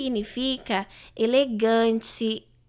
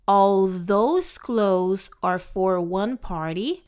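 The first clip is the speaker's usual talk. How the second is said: slow teaching read; slower than usual. Shown as a demonstration. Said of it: "Vou falar mais uma vez, pra vocês pegar a pronúncia".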